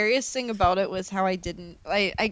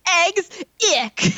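quoting the shopkeeper; 9 semitones higher, 4 dB louder than usual. Retold or imitated. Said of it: "And he goes".

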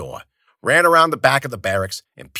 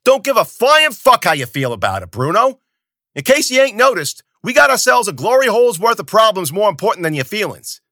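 loud, high angry yell; 11 semitones higher, 7 dB louder than usual. Acted. Zoot yelling at Bruno on the porch about problems, loud and high. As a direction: bellow it down at him. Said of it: "Zoot was already yelling".

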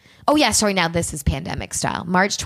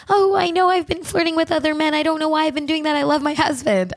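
pleading excuse; 7 semitones higher than usual. Retold or imitated.

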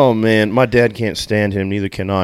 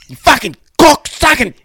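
high, loud yelling with a cracking voice; hammed-up Aussie tirade; mock Aussie heckler; 6 dB louder than usual. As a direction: howl abuse like a drunk Australian fan. Friend joins mocking drunk heckler.

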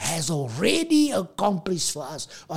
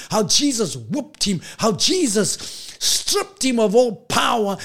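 higher, rising sing-song sermon climax; preaching at full force; 5 semitones higher, 4 dB louder than usual. Acted. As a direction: preach it up to a rousing peak.

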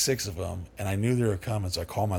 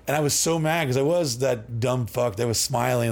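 ordinary delivery

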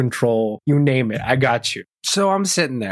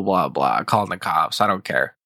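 usual speaking voice

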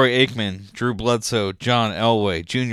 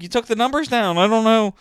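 high; 9 semitones higher than usual. Retold or imitated. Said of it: "And I was like".

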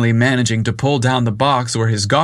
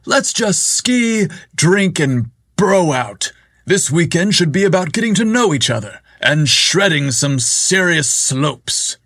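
bro boss voice; 6 semitones higher than usual. Acted. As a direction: play the party bro.

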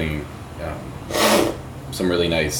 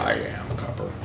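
dramatic whisper; 7 semitones lower, 7 dB quieter than usual. Retold or imitated. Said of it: "that's hilarious".

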